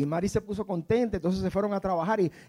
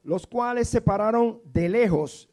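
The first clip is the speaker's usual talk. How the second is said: usual speaking voice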